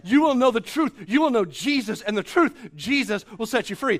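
full-force sermon delivery; preaching. Acted. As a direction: proclaim it to the room. Preaching on knowing Jesus.

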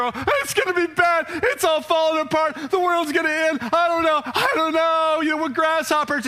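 high, loud, mock-panicked whining; hammed-up panic of the fearful spies; panicky spies. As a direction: ham up the doom-saying spies in a frantic high voice. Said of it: "all these other spies are".

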